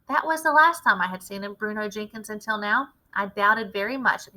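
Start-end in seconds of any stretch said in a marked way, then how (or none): none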